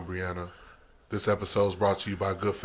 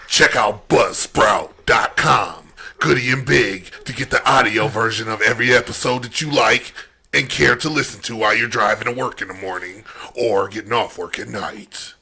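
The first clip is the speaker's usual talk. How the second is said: salesman voice; 7 dB louder than usual. Acted. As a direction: sell it like an ad man. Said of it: "do y'all like my salesman voice deal".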